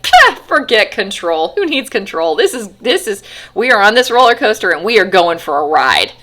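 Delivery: loud, carefree mock cheer; reckless glee. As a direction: throw control away like a thrill-seeker. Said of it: "a lack of control".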